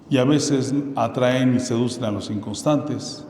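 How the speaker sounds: ordinary talk, nothing marked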